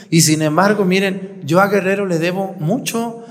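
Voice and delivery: usual speaking voice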